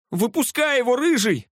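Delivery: high, urgent call; shouted order. A narrator's reading. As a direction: order a mate across the car. Driver orders his friend.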